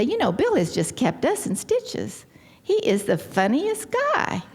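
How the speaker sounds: high-pitched gushing, mildly hammed-up; gushing guest. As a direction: imitate a delighted party guest, pitch lifted. Gushing guest praises husband at reception.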